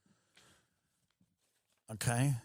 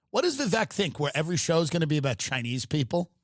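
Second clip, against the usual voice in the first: usual speaking voice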